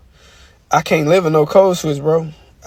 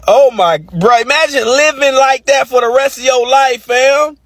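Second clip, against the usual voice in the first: usual speaking voice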